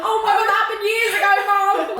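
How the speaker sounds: high-pitched protest to a parent; mock exasperation. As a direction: complain like a put-upon daughter, playfully. Imitates own protest at mum.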